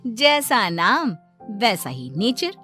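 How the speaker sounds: plain reading voice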